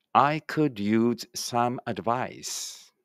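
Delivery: slow, carefully spaced English for the class to repeat; slow model reading. Shown as a demonstration. Teacher models English for pupils reading aloud together, slow and clear. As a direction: say each word slowly and clearly, like a teacher modeling. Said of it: "一緒に声出してください".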